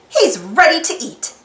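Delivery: usual speaking voice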